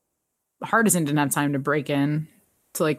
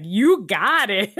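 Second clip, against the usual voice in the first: slow, drawn-out cheesy grin voice; mock cheesy henchman; cheesy thumbs-up line; 4 semitones higher, 3 dB louder, slower than usual. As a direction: deliver it like a smug crook agreeing. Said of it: "cheesy thumbs up and he's like".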